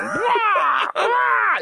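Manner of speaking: high, loud mock cries of wonder; joking awestruck wailing; mock awed cry. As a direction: wail in hammed-up amazement at giant birds. Awe at space condors.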